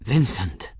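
acted grief, voice breaking on the name; breaking sob. A narrator's reading. Benedict grieving over the dead monk, voice breaking. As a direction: call the name with a touch of a sob. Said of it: "his voice broke with a ragged sob".

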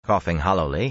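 ordinary delivery

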